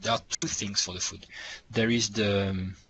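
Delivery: ordinary talk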